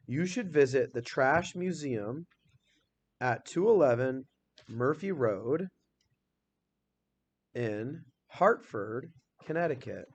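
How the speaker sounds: You should visit read slowly, phrase by phrase; slow reread. Shown as a demonstration. Teacher rereading the sentence slowly while editing with the class. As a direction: reread it slowly, pausing to check each part. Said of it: "I'm reading over and over".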